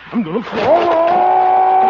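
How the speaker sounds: high, loud, slow cry while falling; panicked cry. Acted. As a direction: yell as you lose your balance. Losing balance.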